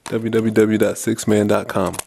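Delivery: slow, loud promo read; announcer voice. Acted. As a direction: announce the web address. Plugging the website.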